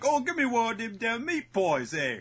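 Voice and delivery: high, loud hammed-up British accent; mock British. Ordering meat pies on a date, mock British accent. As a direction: play a jolly British bloke ordering pies. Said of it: "pretend to be British and be like".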